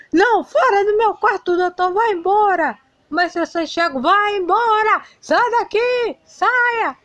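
high, strained angry yelling; throwing him out. Acted. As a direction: chase the doctor out of the room. Boy throws doctor out.

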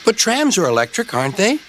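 put-on high-pitched child's query; puzzled child. A narrator's reading. Child questions grandfather in story.

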